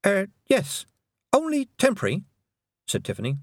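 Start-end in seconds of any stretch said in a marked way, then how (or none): 0.1-2.3 put-on high, hesitant girl; young girl voice. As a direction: answer a bit sheepishly. Tiffany answering nervously.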